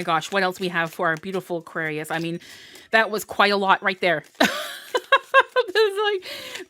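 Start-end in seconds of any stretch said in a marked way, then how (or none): 4.3-5.7 high burst of laughter; real laugh. Real, not acted. Reader overcome during her live reading, laughing high. 5.7-6.7 high, laugh-filled words; laughing while talking. Real, not acted. Reader overcome during her live reading, talking through laughter. Still laughing after outburst.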